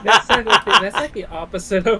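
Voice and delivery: loud laughing through words. Real, not acted. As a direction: laugh while you say it. Laughing at failed voice attempt.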